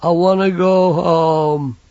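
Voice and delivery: put-on high, slow, childlike big lad; simple lad voice. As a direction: voice him like a big simple boy. Banjo's voice.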